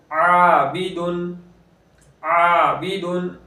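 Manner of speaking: slow, drawn-out Quranic recitation with the alif lengthened; tajweed recitation. Shown as a demonstration. Teacher recites the word slowly for students. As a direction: chant the word slowly, stretching the long vowel. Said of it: "अलिफ को भी बारीक पढ़ेंगे".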